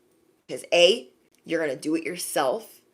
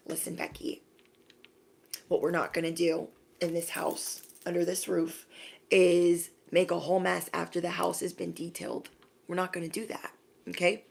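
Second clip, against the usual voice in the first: hushed firm warning; 7 dB quieter than usual. Retold or imitated. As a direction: quietly lay down the house rule. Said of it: "standing up and saying".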